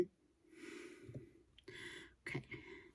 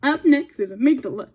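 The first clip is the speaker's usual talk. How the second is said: excited announcement; 5 semitones higher, 11 dB louder than usual. Real, not acted. Announcing next topic.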